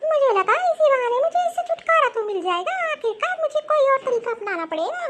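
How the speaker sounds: high-pitched, disgruntled mother-in-law; scheming mother-in-law. Acted. Mother-in-law plotting to be rid of her, disgruntled.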